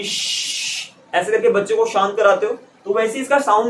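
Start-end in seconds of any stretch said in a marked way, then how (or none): 0.0-0.9 put-on long shushing sound, as if quieting a child; drawn-out sh on breath alone; hushing "shhh". Shown as a demonstration. (बच्चे को शांत कराने वाली लंबी श्श्श)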